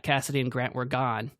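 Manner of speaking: usual speaking voice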